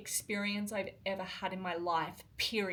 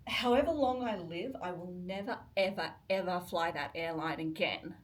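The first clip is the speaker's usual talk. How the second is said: ordinary delivery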